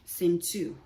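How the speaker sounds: usual speaking voice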